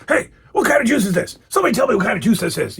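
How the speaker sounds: high demanding impression, speeding up; Mister T. Mister T asking the whole store about juice, demanding impression. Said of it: "He doesn't know what kind of juice it is".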